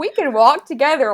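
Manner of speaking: laughing at herself. Retold or imitated. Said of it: "laughing at herself".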